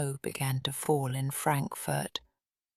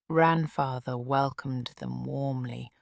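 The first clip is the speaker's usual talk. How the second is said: plain reading voice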